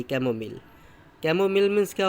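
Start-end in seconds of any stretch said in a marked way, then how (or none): none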